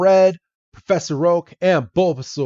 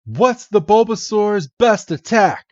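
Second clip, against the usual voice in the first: higher, eager questions; excited Red; 9 semitones higher than usual. Acted. Red amazed by Bulbasaur, asking the professor in high excitement. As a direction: blurt it out thrilled. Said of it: "And Red's like".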